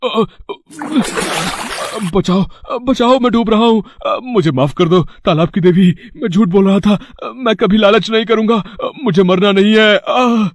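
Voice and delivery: high-pitched panicked gasping; घबराई गुहार. Acted. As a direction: plead for your life while drowning, gulping for air. Drowning, begging for life. (डूबते हुए घबराकर मदद की पुकार)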